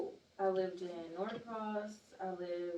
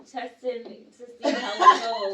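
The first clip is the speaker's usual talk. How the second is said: laughing; 4 semitones higher, 15 dB louder than usual. Real, not acted. Laughing while describing teen personas.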